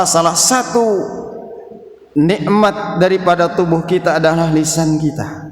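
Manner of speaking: usual speaking voice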